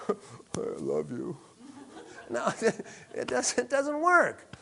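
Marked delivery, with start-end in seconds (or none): 0.1-1.3 much softer, slow, in a put-on gloomy voice; mock unhappy lover. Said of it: "who's ever said, I love something". 2.3-2.6 a real laugh through "No", softer. Real, not acted. Laughs at his own joke.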